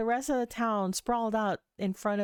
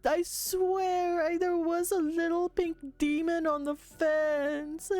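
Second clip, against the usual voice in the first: put-on high, slow, tearful little girl pleading; crying little girl; 10 semitones higher, slower than usual. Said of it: "She's like".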